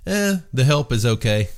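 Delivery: playful dig at friends, pretending to be underwhelmed; teasing. Regular ribbing the staff at his watering hole, teasing. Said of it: "I'm just kidding".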